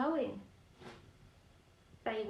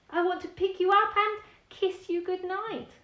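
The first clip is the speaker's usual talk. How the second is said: higher, louder friendly dragon; the dragon cheerfully wanting a goodnight kiss; 8 semitones higher, 8 dB louder than usual. Acted. The dragon speaks.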